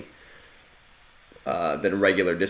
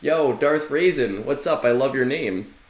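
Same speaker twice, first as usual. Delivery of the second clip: higher, louder, eager hello to a stranger; hyped-up friendly greeting; 6 semitones higher, 4 dB louder than usual. Retold or imitated. Inviting Caleb's friend into the Discord, higher and louder with eager cheer. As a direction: call out to him like an excited buddy. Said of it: "give him a link and be like".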